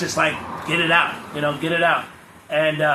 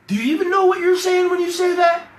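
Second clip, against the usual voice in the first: high, incredulous mock challenge; mocking disbelief; 12 semitones higher than usual. Mocking his spiritual friend, high incredulous challenge. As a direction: sneer at the friend's big talk, pitched way up.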